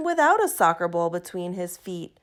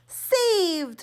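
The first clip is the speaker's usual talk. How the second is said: joyful shout; 8 semitones higher than usual. A narrator's reading. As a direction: shout it as the save lands. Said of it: "Franklin shouted".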